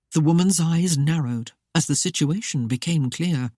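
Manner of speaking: usual speaking voice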